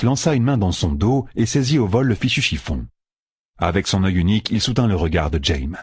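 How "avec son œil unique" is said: plain reading voice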